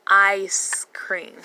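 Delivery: "ice cream" slow and loud, the I drawn out; long I sound. Shown as a demonstration. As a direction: stretch the opening vowel of "ice cream". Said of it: "That is the long I".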